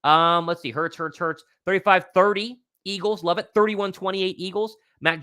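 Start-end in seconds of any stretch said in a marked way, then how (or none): none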